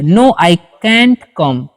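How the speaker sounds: each word spaced out slowly for students; slow dictation. Shown as a demonstration. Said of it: "इस तरीके से बोल सकते हैं". (धीमे-धीमे बोलकर लिखवाना)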